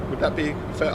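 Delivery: ordinary delivery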